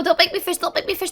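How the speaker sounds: normal talking voice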